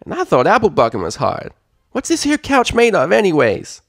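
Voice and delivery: loud, high filly complaint; straining filly. Acted. Applebloom griping while lifting, loud filly voice. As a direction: grumble through the effort.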